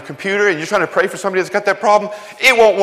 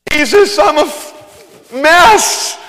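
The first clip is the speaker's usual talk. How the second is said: high, loud, slow cry to Jesus; desperate confession; 11 semitones higher, 6 dB louder, much slower than usual. Retold or imitated. A sinner laid down before Jesus, crying out desperately. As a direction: break down and admit it all. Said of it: "lay down and go".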